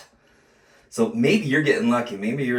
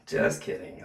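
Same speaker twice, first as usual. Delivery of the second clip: ordinary delivery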